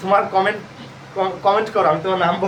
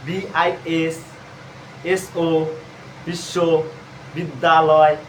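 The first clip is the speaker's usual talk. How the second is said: slowly drawn out; 2 semitones lower, much slower than usual. Shown as a demonstration. Drawing out the word while writing.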